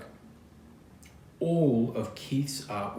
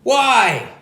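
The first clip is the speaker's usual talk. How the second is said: shouting together; 10 dB louder than usual. A narrator's reading. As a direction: yell it as an eager crowd demanding answers. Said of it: "together they shouted".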